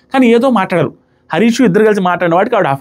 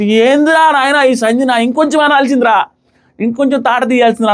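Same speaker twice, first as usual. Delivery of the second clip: exasperated egging on; 5 semitones higher than usual. Retold or imitated. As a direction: cheer her on to hit harder, a bit hammed. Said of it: "అనే feeling వచ్చింది నాకు".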